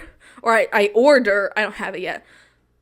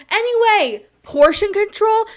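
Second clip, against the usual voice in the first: indignant disbelief; 9 semitones higher, 4 dB louder than usual. Real, not acted. Ranting in disbelief.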